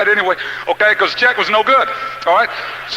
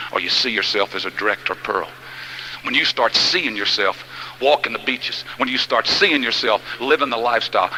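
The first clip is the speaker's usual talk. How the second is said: much lower and softer, confiding; hushed preaching; 10 semitones lower, 6 dB quieter than usual. Real, not acted. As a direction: draw them in with a low, intimate voice. Continues low visualizing sermon.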